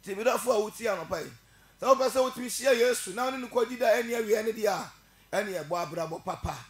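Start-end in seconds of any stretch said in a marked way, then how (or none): 1.8-4.9 loud, higher fiery preaching; preaching at full force. Real, not acted. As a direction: call the congregation to repent, full voice. Preaching surge again.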